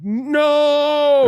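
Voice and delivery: long, high, loud drawn-out no; hammed-up cry of panic, held long; mock panicked wail. Acting out his panic in story.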